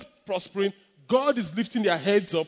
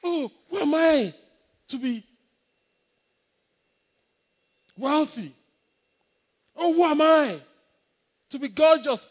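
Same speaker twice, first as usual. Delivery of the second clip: mocking self-doubt; 5 semitones higher than usual.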